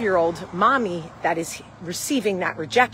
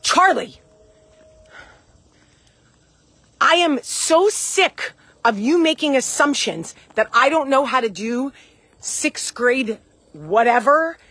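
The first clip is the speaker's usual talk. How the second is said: raised, louder defensive snap at her daughter; retold angry outburst; 5 semitones higher, 5 dB louder than usual. Mom retells snapping at her daughter on the stairs, angry and raised. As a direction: let the frustration boil over, pushing higher and louder. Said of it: "much less intimidating, defensive way".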